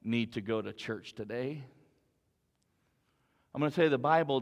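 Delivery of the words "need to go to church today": coaxing voice. Acted. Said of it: "they may shout at you".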